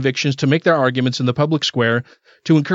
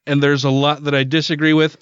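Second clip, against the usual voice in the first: ordinary delivery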